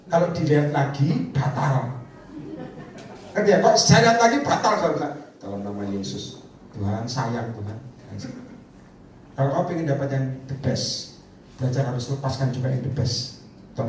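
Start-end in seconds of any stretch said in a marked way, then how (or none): 5.4-7.8 soft, lower and slow, tender devotion; reverent hushed prayer. Retold or imitated. Preacher retells his tender prayer, soft and slow. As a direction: pray it slowly and tenderly to God.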